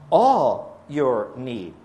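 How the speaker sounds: very slow, each word drawn out; slow emphatic reading. Acted. Preacher slowly stressing the promise to his congregation. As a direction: let "all your need." land slowly, word by word.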